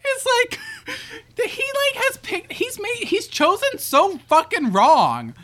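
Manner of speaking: high, breaking with laughter; laughing hard. Real, not acted. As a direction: talk through a laughing fit. Laughing fit while talking.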